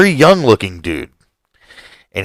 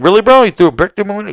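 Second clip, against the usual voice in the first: much higher, louder incredulous accusation; accusing; 10 semitones higher, 5 dB louder, faster than usual. Retold or imitated. Homeowner accuses the neighbor about the brick, incredulous. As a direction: call him out loud and sarcastic.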